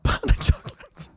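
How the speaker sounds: says it through a high laugh. Real, not acted. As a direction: giggle the words out. Laughing repeat.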